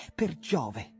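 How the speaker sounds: loud, high exclamation of joy; said aloud. A narrator's reading. Jim exclaiming to his friend, loud and high. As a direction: burst out with it. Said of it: "disse forte".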